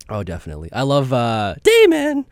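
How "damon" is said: high, loud drawn-out shout of a name; mock name-calling cry. Co-host quoting a favorite show, high mock shout. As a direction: call it out high as a comic imitation.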